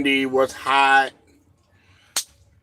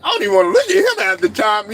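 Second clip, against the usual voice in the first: laughing disbelief; 10 semitones higher than usual. Retold or imitated. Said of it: "you like".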